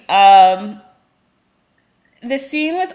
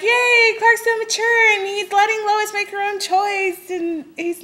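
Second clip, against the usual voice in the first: much higher, giddy fangirl cheer; mock cheering fan; 11 semitones higher than usual. Mocking fans who praise mature Clark, higher and gushing. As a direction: gush like a starry-eyed fan. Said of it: "a lot of people are like".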